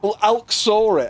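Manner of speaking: normal talking voice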